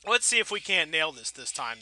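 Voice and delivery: normal talking voice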